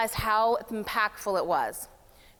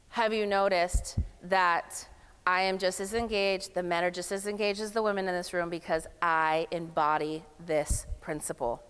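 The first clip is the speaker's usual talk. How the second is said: ordinary talk, nothing marked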